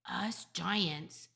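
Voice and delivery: ordinary delivery, nothing marked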